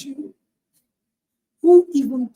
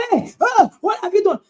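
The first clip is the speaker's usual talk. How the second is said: loud, higher mock shout at a child; mock scolding shout; 6 semitones higher, 4 dB louder than usual. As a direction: play the furious mom yelling. Mother about to scold daughter.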